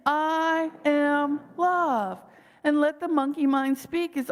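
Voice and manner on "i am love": I am love drawn out very slowly; very slow affirmation. Shown as a demonstration. Speaker repeats affirmation again, even slower. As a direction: linger on every word, fully sincere. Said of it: "And then say it again".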